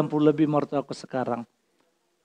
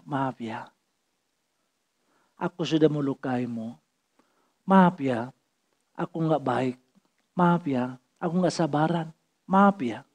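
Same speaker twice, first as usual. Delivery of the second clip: soft, lower, humble plea; voicing how a believer should apologise, softly; gentle apology; 4 semitones lower, 7 dB quieter than usual. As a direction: say sorry tenderly, almost to yourself.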